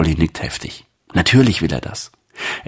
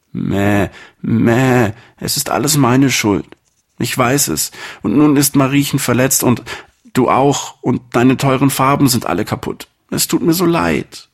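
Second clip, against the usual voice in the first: high, small lamb voice, full of remorse, bleating at the start; a guilty little lamb begging forgiveness; little lamb pleading; 8 semitones higher, 3 dB louder than usual. Acted. Lamb Pauli apologizes to shepherd. (hohe Lämmchenstimme, reumütig und kleinlaut)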